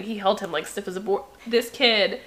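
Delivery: ordinary delivery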